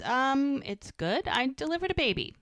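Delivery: retold attempt at finding the positive; trying to sound positive. Woman asked about her day by her partner, trying to sound positive. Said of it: "She tries to find the positive".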